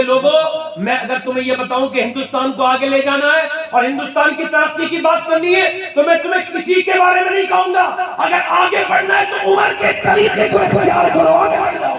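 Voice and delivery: high, loud orator's shout; rally the crowd from the stage; rousing political speech. Acted. Gandhi to the nation, shouting like an orator.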